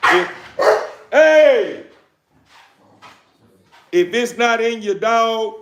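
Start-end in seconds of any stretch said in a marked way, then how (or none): 1.1-1.8 a loud, high "hey" at the dog; sharp shout. Real, not acted. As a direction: bark it out to stop the dog. Yelling at barking dog.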